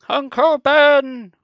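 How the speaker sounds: high, loud, drawn-out cry for help; stretched-out warning shout; acted scream. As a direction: scream the name out long and high. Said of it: "I finally managed to scream".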